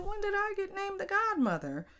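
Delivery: high disbelieving squeak; surprised. Retold or imitated. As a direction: shoot up high in shock. Shocked at being named godmother.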